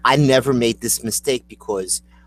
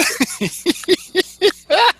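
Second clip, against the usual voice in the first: high, repeated staccato cackle; high mascot laugh. Acted. Co-host finishing cereal joke, high character cackle. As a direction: laugh up high like a silly monster.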